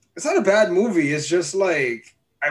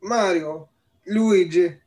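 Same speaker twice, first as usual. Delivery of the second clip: put-on Italian accent; slower than usual. Host trying out Mario's voice for co-host, mild Italian accent. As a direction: put on a little cartoon Italian. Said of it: "talking a stereotypical Italian accent".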